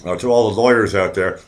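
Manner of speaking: ordinary talk, nothing marked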